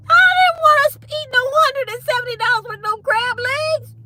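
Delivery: high, loud indignant aside; exasperated squeal. Real, not acted. Telling viewers about the price, high exasperated squeal. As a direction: squeak it out in disbelief.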